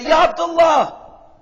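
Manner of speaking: high, loud, drawn-out hail; calling out. Acted. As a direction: call out to a neighbor from afar. Neighbor calling out to you.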